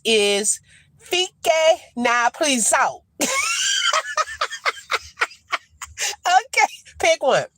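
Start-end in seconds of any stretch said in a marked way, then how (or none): 3.2-5.0 squealing laugh up high; high shrieking laugh. Real, not acted. Laughing at the translation.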